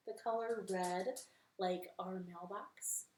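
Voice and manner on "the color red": plain reading voice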